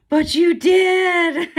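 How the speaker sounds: high, loud and drawn out; imitated delighted son. Mother imitates her son opening his Oculus, high delighted squeal. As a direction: squeal it like an overjoyed kid. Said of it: "And he's like".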